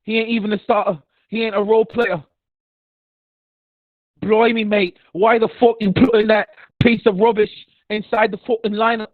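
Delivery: hammed-up British accent, loud; mock British accent. Ranting about Grant Williams in a loud mock British accent. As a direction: rant at the coach in a fake British accent. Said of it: "got me sounding British".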